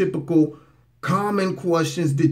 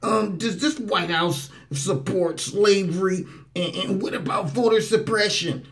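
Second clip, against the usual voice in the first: mocking April's question; 5 semitones higher than usual. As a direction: mimic a reporter asking loaded questions.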